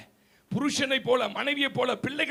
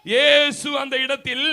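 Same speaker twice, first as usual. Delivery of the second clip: emphatic reading; 7 dB louder, slower than usual. Acted.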